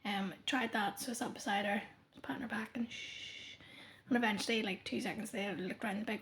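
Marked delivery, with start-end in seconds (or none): 1.0-3.6 soft hushed bedtime whisper. Retold or imitated. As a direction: whisper as if beside a sleeping child. Shushing a toddler to sleep.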